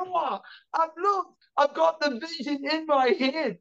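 put-on high, drawn-out childish lament; hammed-up traumatised kid; mock horror. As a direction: wail it as if scarred for life. Child self horrified at sight.

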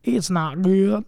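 drawn-out imitation Southern drawl; Southern accent. Husband echoing his wife to show off his practiced accent, imitation Southern. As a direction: mimic a Southern accent, slowly. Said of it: "my Southern accent is, I've been working on it".

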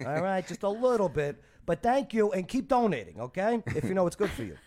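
veiled threat in a mock Jersey accent. Restaurant nudging donors to keep giving, mock Jersey accent. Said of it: "say it more like Jersey".

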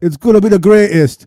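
loud, high hype-man DJ voice; mock hype DJ. Mocking the BBC DJ hyping [name]'s freestyle, loud and high. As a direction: ham up an overexcited DJ. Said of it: "DJ hyping up this freestyle".

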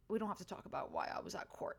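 hushed secret aside; whispering. Real, not acted. Aside about court.

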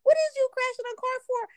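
high-pitched retold disbelief; imitating her own alarmed objection; incredulous protest. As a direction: push the voice way up in protest. Retelling her shocked reply to husband.

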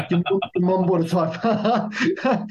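slow, laughing disbelief, imitating the detailer; mock amazement, drawn out; imitating the detailer's amazed reaction to his mum's car; incredulous reaction. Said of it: "that exact same reaction".

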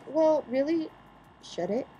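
ordinary talk

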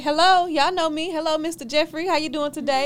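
light mock familiarity; breezy regular at court. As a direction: greet the clerks like old friends. Mimicking court regular greeting.

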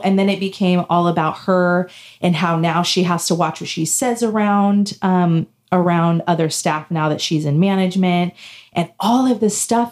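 normal talking voice

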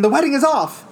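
high, loud proclamation; dramatic announcement. Retold or imitated. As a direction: announce it like a stage twist. Quoting the show announcement.

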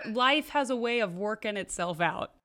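ordinary delivery

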